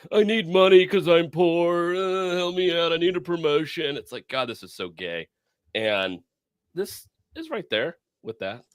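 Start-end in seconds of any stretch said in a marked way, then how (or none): 0.1-4.0 high, slow, whiny mock plea. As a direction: mimic a pathetic whining agent. Mocking the FBI agent's whining.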